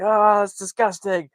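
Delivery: high, loud, drawn-out cry of disgust; mock freak-out. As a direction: act out a horrified freak-out, stretched and high. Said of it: "He's, like, freaking out".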